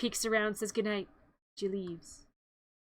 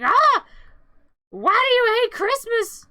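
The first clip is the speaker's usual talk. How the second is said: high, loud, drawn-out wail; mock outrage; 10 semitones higher, 9 dB louder, slower than usual. Girl mimics people questioning her about Christmas, mock outraged. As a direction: mimic appalled people, way over the top.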